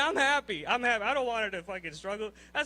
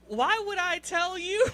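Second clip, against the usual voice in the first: laughing disbelief; 7 semitones higher, 4 dB louder, slower than usual. Real, not acted. Incredulous rant punchline, laughing.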